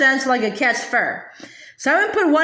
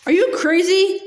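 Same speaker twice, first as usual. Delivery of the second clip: hollered; 6 semitones higher than usual. A narrator's reading. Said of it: "hollered at her brother".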